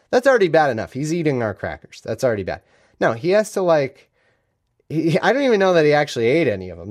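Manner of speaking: ordinary talk, nothing marked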